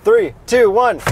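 counting down, loud and high; loud countdown. Real, not acted. Loud countdown to his co-host before the test.

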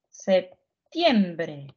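септємбре stretched out slowly, syllable by syllable; slow model word. Shown as a demonstration. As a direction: draw септємбре out for them to copy. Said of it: "де?". (повільно: септ-ємб-ре)

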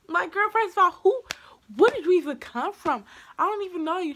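loud, high-pitched sassy outrage; acted-out loud, affronted challenge; indignant telling-off. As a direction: confront the nurse, voice shooting up. Retold clash with shushing nurse.

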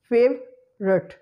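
slow "favorite" in two parts, FAVE, rut; schwa after V deleted. Shown as a demonstration. Teacher drilling the hack, "favorite" slowly split as FAVE-rut. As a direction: split "favorite" with no middle vowel. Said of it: "Schwa sound after V is deleted".

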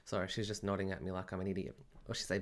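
normal talking voice

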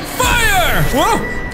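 normal talking voice

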